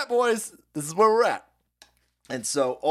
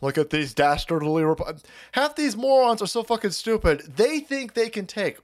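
ordinary talk

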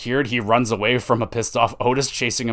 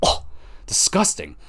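mock disgusted; 4 dB quieter than usual. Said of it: "really unimpressed look".

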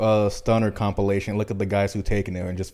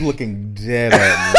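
laughing; 7 dB louder than usual. Real, not acted. Teasing while laughing.

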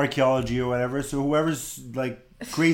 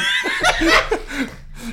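burst of laughter; 14 semitones higher than usual. Real, not acted. Laughing at own accent bit.

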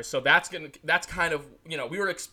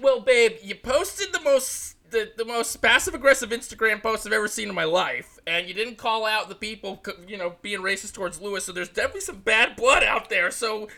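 higher, louder sassy voice; high, louder catty comeback to the driver; sassy mock retort; 6 semitones higher, 5 dB louder than usual. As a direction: talk back to Max like a catty friend. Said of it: "And it is like".

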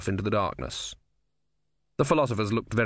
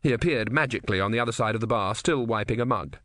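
ordinary delivery

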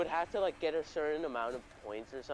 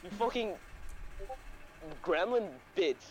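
imitating pissed mom; 7 semitones higher than usual. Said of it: "she was, like, pissed at me".

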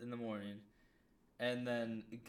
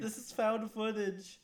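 excited; 10 semitones higher, 3 dB louder than usual. Real, not acted. Joking repeat, raised.

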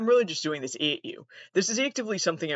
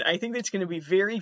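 ordinary talk

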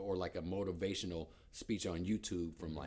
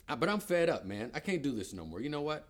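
louder, higher, exasperated outburst; fed up; 7 semitones higher, 5 dB louder than usual. Acted. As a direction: snap out of it, done with the mess. Acted frustration, fed up with mess.

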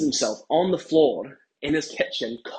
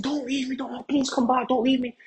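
squealed mock plea; mock pleading shout; 11 semitones higher than usual. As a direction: imitate a husband crying out for her to stay. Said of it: "So he starts shouting at her".

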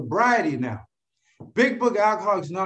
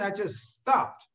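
ordinary talk

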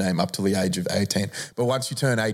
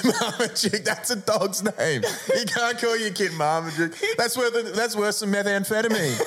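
high, laughing outrage at the name; laughing disbelief; 11 semitones higher than usual. Real, not acted. Mocking a celebrity's kid name, laughing.

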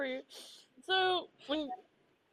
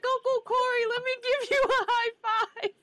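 put-on high, louder sing-song kid chasing; eager toddler; 13 semitones higher, 6 dB louder than usual. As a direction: chirp it like a cheeky little boy. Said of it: "Colton would chase after my brother and be like".

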